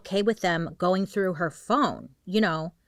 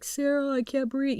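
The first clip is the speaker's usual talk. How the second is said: higher trapped plea for help; pleading call; 8 semitones higher than usual. Retold or imitated. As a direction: cry out to her, still strong. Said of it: "he's like".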